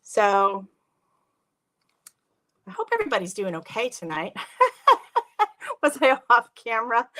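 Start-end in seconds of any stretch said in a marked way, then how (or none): 4.4-5.8 sudden high laugh at herself; embarrassed giggle; burst of laughter. Real, not acted. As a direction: laugh out, caught off camera. Caught off camera.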